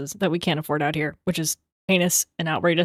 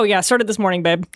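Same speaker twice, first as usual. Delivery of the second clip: usual speaking voice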